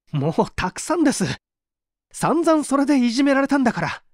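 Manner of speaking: rising, fast and exasperated, voice climbing high; fed up outburst. Acted. Suspect to interrogator, fed up and exasperated, high and fast. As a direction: snap back, sick of being hounded.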